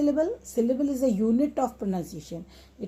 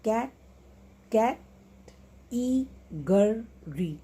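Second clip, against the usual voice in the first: "cat, cat, eagerly" as category in slow, separate beats; "eagerly" broken slowly into e, go, ry; syllable by syllable; much slower than usual. Shown as a demonstration. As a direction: pause between each syllable, cat... e... go... ry. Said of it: "Then four syllables words".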